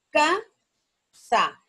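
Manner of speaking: "Casa" as a loud CA, long gap, then sa; syllable by syllable. Shown as a demonstration. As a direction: stretch "Casa" into two separated beats. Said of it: "¿dónde suena más fuerte?".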